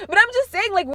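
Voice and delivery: ordinary talk, nothing marked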